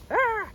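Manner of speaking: short, high, loud yelp; sharp warning cry, pitch shooting up; startled yelp. Real, not acted. Warning off a goat that wants to fight, high yelp.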